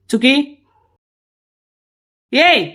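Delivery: sharp startled call out; a sudden loud hey to stop her; startled shout. Acted.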